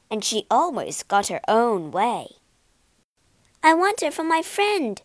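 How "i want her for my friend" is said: put-on high, insistent girl's voice; robber girl. Robber girl claims Gerda, high and insistent. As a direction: claim her new friend like a toy. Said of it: "said the robber girl".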